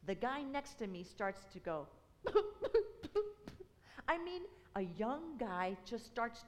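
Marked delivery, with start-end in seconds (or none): 2.2-3.6 high mock weeping sob, loud; imitated weeping sob. Imitating the crying man beside him on the plane, mock sob. Seatmate starts to weep.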